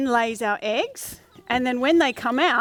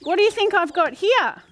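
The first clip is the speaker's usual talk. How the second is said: put-on reading to a toddler; 4 semitones higher than usual. Talks to a duckling for kids.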